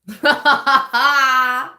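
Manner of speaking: high, delighted giggle; burst of laughter. Real, not acted. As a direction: laugh out loud with glee. Excited laugh over a love reading.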